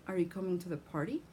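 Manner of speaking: quick question, "party" swooping up; rising intonation. Shown as a demonstration. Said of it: "Rising intonation".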